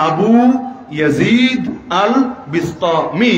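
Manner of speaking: আবু ইয়াজিদ আল বিস্তামি spelled out slowly, word by word with pauses; careful name pronunciation. Shown as a demonstration. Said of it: "মনে থাকবে".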